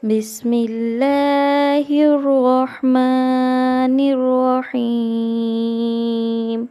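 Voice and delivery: slow, drawn-out Quranic recitation; chanted invocation. Real, not acted. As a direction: intone the opening words slowly and loudly. Opening recitation before class.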